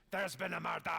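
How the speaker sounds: dramatic mock Scottish announcement; rolled, theatrical Scottish; Scottish accent. Shown as a demonstration. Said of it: "the Scottish accent, probably the best phrase".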